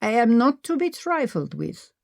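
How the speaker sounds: lightly done fake German accent, mock stern; hint of a put-on German warning. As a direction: play-act a stern German. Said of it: "Maggie said in her fake German accent".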